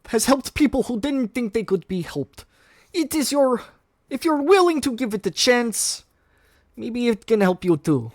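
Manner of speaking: reluctant honest admission; Ilyana voice. Acted. Ilyana softens toward students.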